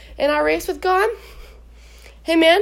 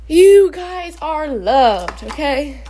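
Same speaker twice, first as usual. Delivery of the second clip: very slow, louder, each word stretched; emphatic assurance; 5 dB louder, much slower than usual. Real, not acted. Speaker assuring viewers they are loved, slow and emphatic. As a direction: make every word land.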